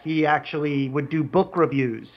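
ordinary talk, nothing marked

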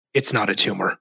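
low, deadpan action-hero line; Schwarzenegger without accent. Acted. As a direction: drop the accent and underplay it. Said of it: "but then his voice is just".

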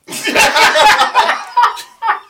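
high burst of laughter; loud laugh. Real, not acted. Laughing at the album.

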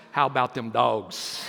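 fake-Greek "dawgs" cheer with "dogs" said "dawgs"; mock Greek pronunciation. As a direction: say "How about them dogs?" like a Texas football chant, "dogs" as "dawgs". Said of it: "it's pronounced".

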